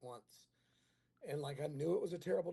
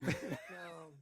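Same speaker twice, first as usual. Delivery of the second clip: ordinary talk, nothing marked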